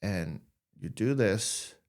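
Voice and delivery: walk them gently through noticing their fingers; guided exercise. Acted. Leads a sensory exercise.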